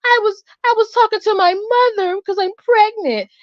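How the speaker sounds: high, put-on mock voice of the woman; mocking the driver. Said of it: "And she's like".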